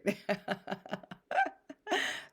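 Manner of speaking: light laugh after a playful line. Real, not acted. Speaker laughs at own playful greeting.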